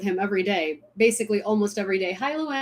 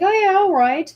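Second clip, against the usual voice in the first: higher, louder mock English accent; breezy imitation of an English accent; hammed-up English accent, pitched up; 11 semitones higher, 6 dB louder than usual. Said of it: "really English accent".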